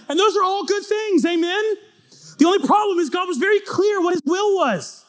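high, shouted sermon delivery; full-force preaching. Acted. Preacher rousing the congregation at full voice. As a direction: preach it to the back row.

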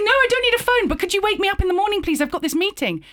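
very high, rushed, gushing request; verbal diarrhea. Retold or imitated. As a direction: babble politely in a flustered squeak. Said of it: "I get utter verbal diarrhea".

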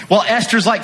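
ordinary talk

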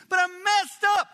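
very high, loud cry; desperate protest. Acted. Messed-up man arguing with God, desperate protest.

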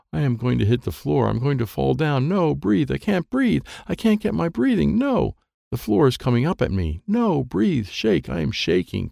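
acted child's panic, pitch climbing; mounting panic. A narrator's reading. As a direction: build from worry to high-pitched panic. Child panics, cannot breathe, about to crash.

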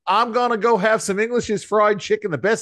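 mock Baltimore local with a broad accent. Host riffing on Baltimore life in a Baltimore accent. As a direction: ham up the Baltimore twang. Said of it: "You gotta use the Baltimore accent".

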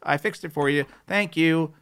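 breezy helpful tech fixing it; helpful support guy. Retold or imitated. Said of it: "And they're like".

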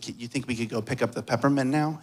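soft, quick fatherly request; gentle coaxing. Retold or imitated. Father asking his reassured son to obey, softly coaxing. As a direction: quietly nudge a child along.

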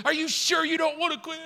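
high-pitched, insistent mock challenge; chief pressing. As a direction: push him as the stern chief. Chief presses crying recruit.

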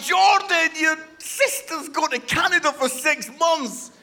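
high, emotional pleading; hammed-up incredulous outburst; mock distraught appeal. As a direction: appeal to an unmoved son. Upset father confronts unmoved son.

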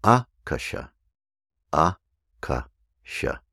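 Akasha slowly, with the first syllable stressed; Sanskrit stress. Shown as a demonstration. Teacher models the correct stress on Akasha slowly. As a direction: Akasha drawn out, AH-ka-sha. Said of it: "third from the end".

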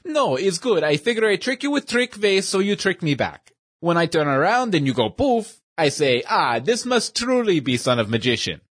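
lightly voiced old shopkeeper with a broken-English accent; a kindly old immigrant magician in broken English.

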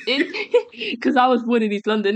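laughing through the words; half laughing as he explains; giggly and amused. Real, not acted.

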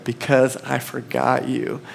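soft, slow guilty admission; sheepish confession. Retold or imitated. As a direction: confess it quietly, slowly. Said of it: "I said".

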